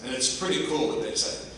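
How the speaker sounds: usual speaking voice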